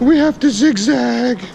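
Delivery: high, slow sarcastic repeat; mocking echo. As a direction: parrot him back with disbelief. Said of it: "We're like".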